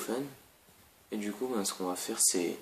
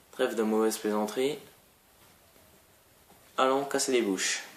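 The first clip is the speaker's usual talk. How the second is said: normal talking voice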